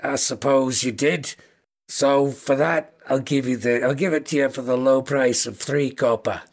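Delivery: understated Scouse newspaper seller; Scouse kobold. Acted. Kobold quotes a customer the paper's price, in Scouse. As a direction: haggle matter-of-factly in a Liverpool accent. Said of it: "very thick scouse kobold".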